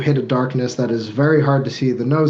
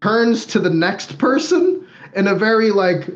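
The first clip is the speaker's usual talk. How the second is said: awkward host; 6 semitones higher than usual. Acted. GM mimics awkward host offering drink.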